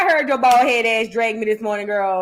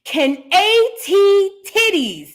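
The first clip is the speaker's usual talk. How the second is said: slow, high, each part held out; imitated challenge; 7 semitones higher, much slower than usual. Host mimics the singer naming down the line, high and drawn-out. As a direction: announce the name high and stretched. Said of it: "that's what she said".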